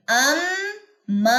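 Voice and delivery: अम्मा slow with ghunna on mm; correct pronunciation. Shown as a demonstration. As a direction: nasalise the mm and hold the a in अम्मा. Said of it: "मद भी अदा होगी". (अम्मा गुन्ना और मद के साथ)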